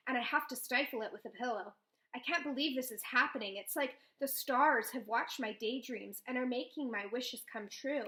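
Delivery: ordinary delivery, nothing marked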